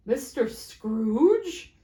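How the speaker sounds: very slow questioning name; doubtful surprise. Acted. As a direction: hesitate in disbelief. Gentleman surprised.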